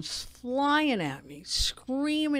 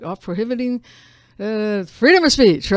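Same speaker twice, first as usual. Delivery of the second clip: skimming aloud; 10 dB louder than usual. Retold or imitated. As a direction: skip past it like it's obvious. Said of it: "I kinda just go over that one 'cause I'm like".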